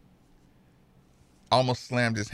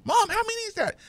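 high falsetto, deadpan daughter asking; the teenager keeping a straight face; daughter's voice; 4 dB quieter, much faster than usual. Retold or imitated. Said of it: "And she's like".

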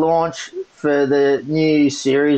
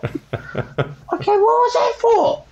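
breathy start, then jumping much higher in shock; stunned disbelief; much slower than usual. Retold or imitated. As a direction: sound winded and baffled. Retold reaction to being punched.

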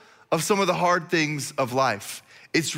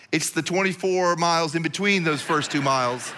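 usual speaking voice

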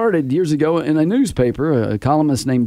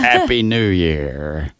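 lightly voiced pirate accent; 7 semitones lower, much slower than usual. Shown as a demonstration. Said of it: "See, you go".

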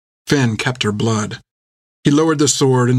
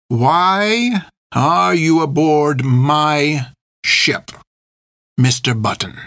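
menacing captain; 4 semitones higher, much slower than usual. A narrator's reading. The captain questions Finn, slow and menacing. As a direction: draw each word out like a threat.

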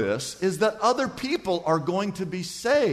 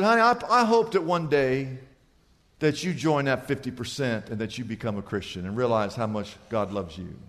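tender hush; 8 semitones lower, 3 dB quieter than usual. Retold or imitated. Pastor quietly telling the tourist God loves her, tender. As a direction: lower and much softer, fading to near silence.

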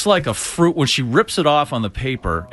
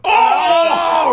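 high laughing shriek; laugh-scream at the rip; 5 dB louder than usual. Real, not acted. Strip ripped, both react.

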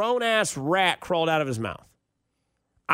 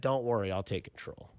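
softer, lower retold reassurance; reassuring partner; 8 semitones lower, 8 dB quieter than usual. Girl reassures nervous teen, retold in a low soft voice. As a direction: drop down low and quiet, imitating her. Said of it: "She said".

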